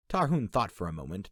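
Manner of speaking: plain reading voice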